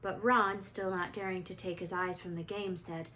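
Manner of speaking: ordinary delivery, nothing marked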